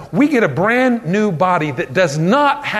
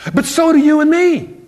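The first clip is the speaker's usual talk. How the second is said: emphatic preaching; 8 semitones higher, 4 dB louder than usual. Real, not acted. Emphatic sermon punchline.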